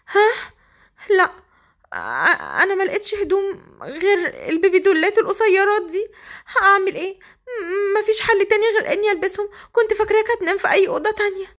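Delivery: high, breaking, near tears and rushing; flustered tearful bride. Acted. Hasnaa caught in the nightgown, explaining through tears. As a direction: stammer out excuses on the edge of crying.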